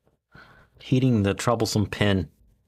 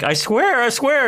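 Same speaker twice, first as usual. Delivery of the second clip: much higher, louder frantic "I swear"; pleading witness; 13 semitones higher, 4 dB louder than usual. Retold or imitated. Thug swearing to Batman, high hammed-up pleading. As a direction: beg as a terrified thug. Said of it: "he's like".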